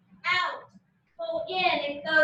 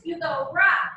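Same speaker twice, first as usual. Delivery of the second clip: ordinary talk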